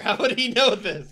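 loud, high laughing objection; incredulous. Real, not acted. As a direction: shout the plot hole in disbelief. Heckles the plot hole, laughing.

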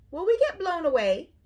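high, loud worried little bunnies; crying out. A narrator's reading. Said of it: "they cried".

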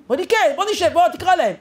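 high, urging agreement; imitated father giving in; eager climb-down. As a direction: agree keenly, pitched high.

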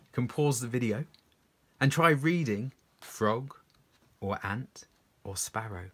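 normal talking voice

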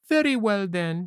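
high puppet voice, dropping at the end; puppet Pinocchio. Acted. Puppet gives in to eating leftovers, high childish voice. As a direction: give in with a high little voice.

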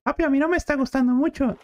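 put-on high, fast whiny kid voice; son voice. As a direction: play the complaining little boy.

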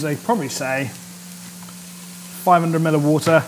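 normal talking voice

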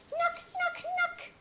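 high, sing-song knock knock; mock knocking. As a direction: knock playfully on the door. Acting the kids knocking.

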